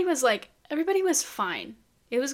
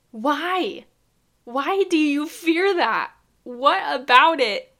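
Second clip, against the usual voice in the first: exasperated; 3 semitones higher, 6 dB louder, slower than usual. Real, not acted. Baffled by fear of eight.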